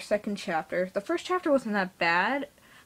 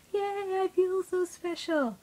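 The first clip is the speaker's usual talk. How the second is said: mock-excited British accent, much higher and slow; sarcastic yay; 11 semitones higher, slower than usual. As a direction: cheer with heavy sarcasm, high and drawn out. Sarcastic cheer about few submissions.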